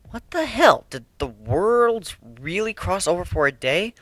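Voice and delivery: ordinary delivery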